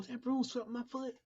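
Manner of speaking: mock-panicked high voice; mock shocked believer. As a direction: parody someone horrified by a broom. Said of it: "Christians too that talks like that".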